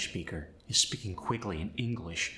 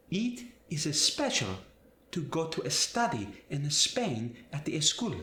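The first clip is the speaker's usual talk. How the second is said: Spanish accent, an E before Spain and study, higher; 5 semitones higher than usual. Shown as a demonstration. Teacher demonstrating the added E, Spanish accent. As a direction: add an E before every S-word. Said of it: "add that E to English words".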